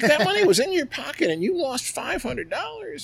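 high, exasperated scolding; scolding wife. Retold or imitated. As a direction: lecture him, still annoyed. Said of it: "She's like".

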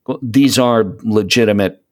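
normal talking voice

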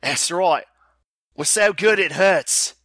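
slow, hammed-up Australian accent; terrible Australian accent. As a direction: do a bad Aussie, drawn out. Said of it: "my terrible Australian accent".

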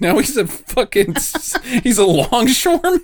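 high, giggling delivery; laughing. Real, not acted. Teasing friend about bad accents, laughing. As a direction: laugh through the joke. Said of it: "The accents are just gonna get worse".

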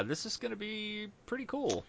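ordinary talk, nothing marked

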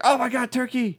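high, put-on doggy exclamation; excited dog. Chance smelling dinner, thrilled. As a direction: marvel greedily at dinner. Voices Chance's closing narration.

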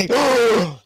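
high, sharp gasp of delight; excited gasp. Acted. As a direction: gasp like big news is coming. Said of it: "I'm just gonna do an excited sound".

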